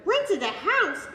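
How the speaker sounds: loud, high, slow outraged shout; the aunt explodes at her niece, loud and high. Acted. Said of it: "she shouted".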